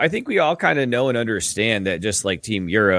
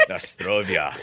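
lower, laughing Russian accent; 4 semitones lower, 4 dB quieter, slower than usual. Shown as a demonstration. Podcaster trying another country, laughing mock Russian accent. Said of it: "Russian accent".

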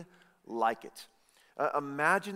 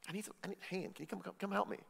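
gentle, softer ask for a hand; softer polite request; 6 semitones higher, 10 dB quieter, much faster than usual. Retold or imitated. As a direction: Martha asking sister kindly, soft and polite. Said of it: "She didn't say".

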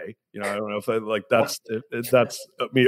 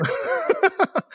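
high laughing burst. Real, not acted. Host breaking up at his accent bit, real laugh. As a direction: crack up again.

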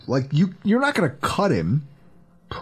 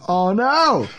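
mock alarm; 6 semitones higher than usual. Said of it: "everyone goes".

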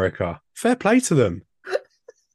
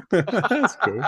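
laughing; 7 semitones higher than usual. Real, not acted. Laughing reply.

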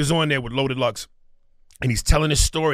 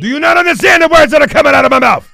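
high, loud Chris Tucker shout from Rush Hour; Chris Tucker impression; 10 semitones higher, 9 dB louder, faster than usual. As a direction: yell it like Chris Tucker losing patience. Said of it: "You see the movie Rush Hour with Chris Tucker".

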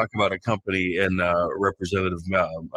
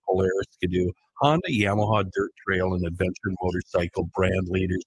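usual speaking voice

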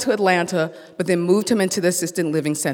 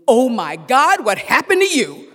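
retold scream; 7 semitones higher, 5 dB louder than usual. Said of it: "I leap back and scream".

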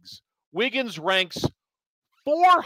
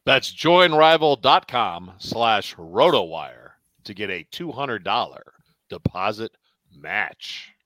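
deep ad voice; 9 semitones lower than usual. Pretend. Reads ad line in deep voice.

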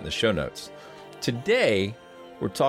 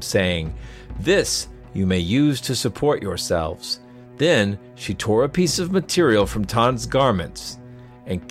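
measured storyteller's read over music; story narration; 4 dB louder than usual. A narrator's reading.

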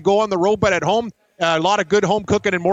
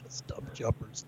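giggling through the words; laughing; 8 semitones lower, 20 dB quieter, much slower than usual. Real, not acted. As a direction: laugh it out breathlessly. Laughing repeat of nickname.

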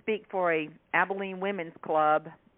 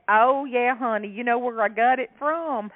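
louder, higher Southern honey voice; country accent; 5 semitones higher, 5 dB louder than usual. Retold or imitated. Shopper confiding to the author, chatty country voice. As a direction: lean in like a chatty country neighbour. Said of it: "She said".

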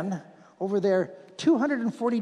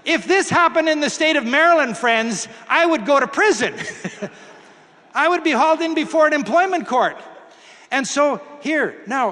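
raised, emphatic pulpit voice; preaching; 5 semitones higher, 5 dB louder than usual. Acted. Preacher telling the congregation, emphatic pulpit delivery. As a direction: drive the point home to the congregation.

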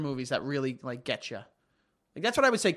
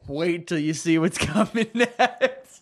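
laughing filmmaker; slower than usual. Retold or imitated. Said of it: "they were like".

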